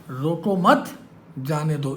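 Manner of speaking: slow रोको मत, then जाने दो; pause after मत. Retold or imitated. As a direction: stretch रोको मत with weight on मत, break before जाने दो. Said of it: "इन्होंने बोल दिया". (रोको मत पर ठहराव, फिर जाने दो)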